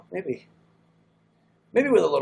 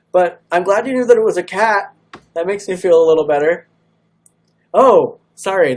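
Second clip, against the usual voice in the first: excited; 6 semitones higher, 11 dB louder than usual. Real, not acted. Relieved and happy about guess.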